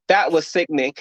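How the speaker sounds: usual speaking voice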